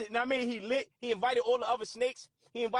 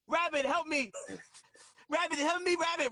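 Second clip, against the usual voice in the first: mild, pitched-up pretend scream; mock scream for help; 4 semitones higher than usual. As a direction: act out a trapped snake calling up high. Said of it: "the snake is screaming".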